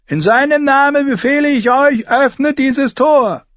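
high, thin, loud command; very high-pitched imperious knight; Sir Amory Lodge. A narrator's reading. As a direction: order them in a piercing, thin voice. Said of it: "Seine Stimme war hoch und dünn".

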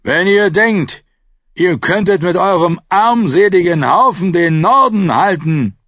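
loud, high shout of outrage; defiant call. A narrator's reading. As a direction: shout contempt at the invaders. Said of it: "doch der Schmied rief".

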